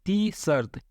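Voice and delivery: ती शर्त with dental t at start and end; tongue on the teeth for both t's in ती शर्त; Arabic-style ती शर्त. Shown as a demonstration. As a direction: say ती शर्त with no hard retroflex t. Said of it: "इसलिए शर्त बोलते हैं".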